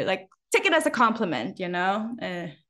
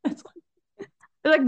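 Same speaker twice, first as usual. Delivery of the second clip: normal talking voice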